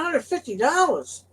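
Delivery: high, slow mocking repeat; scoffing disbelief. Trucker haggling with his boss over pay, high and scoffing. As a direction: scoff at the offer.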